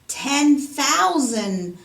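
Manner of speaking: loud and very slow, each word hammered; loud answer. A narrator's reading. Treasurer answers the king, loud and drawn out. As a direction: announce the sum slowly and loudly. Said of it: "the treasurer's answer was loud".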